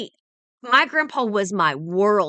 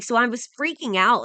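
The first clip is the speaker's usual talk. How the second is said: ordinary talk, nothing marked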